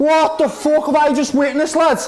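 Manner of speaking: high, shouted disbelief; stunned elation. Real, not acted. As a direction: shout it, unable to believe the goal. Stunned celebration after the goal.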